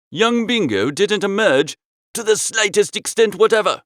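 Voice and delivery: usual speaking voice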